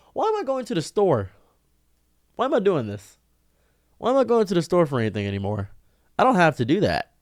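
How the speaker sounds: high-pitched incredulous questioning; exasperated realization. Real, not acted. Man realizing online shopping is cheaper, exasperated.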